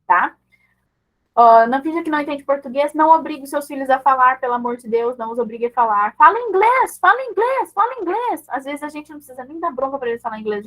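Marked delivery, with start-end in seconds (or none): 6.2-8.4 high-pitched, insistent pestering parent; mock nagging command. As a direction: nag in a high, repeated chant. Mimics parents nagging children. (cobrança insistente em tom agudo)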